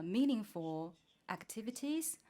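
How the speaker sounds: usual speaking voice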